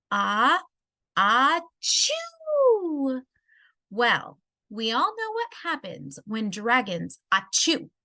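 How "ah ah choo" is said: a drawn-out, rising mock sneeze; ah-ah-choo sneeze, hammed up; big sneeze. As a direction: wind up and let the sneeze burst high. Dragon sneezes.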